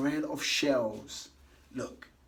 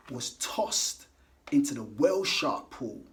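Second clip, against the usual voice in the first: usual speaking voice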